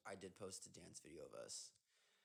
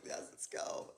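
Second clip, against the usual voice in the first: excited whisper; 10 dB louder, slower than usual. Retold or imitated. Retold cheer to herself.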